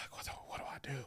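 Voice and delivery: whispered, voiceless panic; frantic whispered what do I do; panicked whisper. Retold or imitated. Panicked inner thought.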